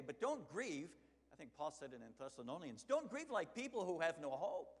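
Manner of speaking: loud, high impassioned preaching. Acted. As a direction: preach it out with full fire. Preaching at full force.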